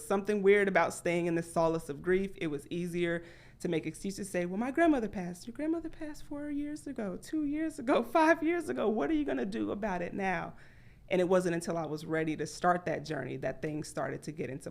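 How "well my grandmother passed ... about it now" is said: high, sarcastic, laughing at herself; mock scolding of her younger self. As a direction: call out her own excuses. Mocking her own excuses.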